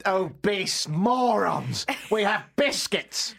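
slow, high, haughty mock outrage; hammed-up national pride; mock indignation. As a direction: draw it out in pretend disdain. Mock national outrage over donuts.

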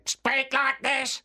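high, slow robotic alien monotone; high-pitched mechanical alien; Dalek voice. Shown as a demonstration. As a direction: drone it like a Dalek, syllable by syllable. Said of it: "order in a Dalek voice".

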